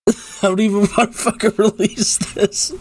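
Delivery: real laugh through tears; embarrassed tearful laugh; crying laugh. Real, not acted. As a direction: laugh it off while still crying. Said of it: "start fucking crying".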